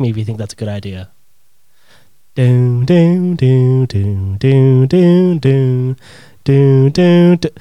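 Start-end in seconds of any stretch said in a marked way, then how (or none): 2.4-7.5 loud chopping do-do-do helicopter noise. Pretend. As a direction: mimic rotor blades with your voice. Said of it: "that's my helicopter impression".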